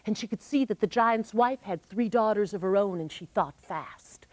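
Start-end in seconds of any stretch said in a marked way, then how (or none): none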